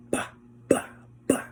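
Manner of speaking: whispered bah bah slap sounds; mock slap noises. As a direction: mime the slaps with breathy pops. Imitating slapping someone.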